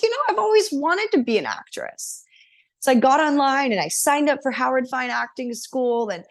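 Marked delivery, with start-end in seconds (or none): 0.0-2.2 excited. Retold or imitated. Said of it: "And I was like".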